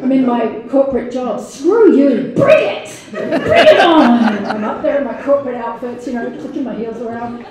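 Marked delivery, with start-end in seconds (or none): 1.4-4.5 laughing, defiant bring it on; mock defiant. To her corporate critics, a laughing defiant dare. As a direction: dare them, half laughing.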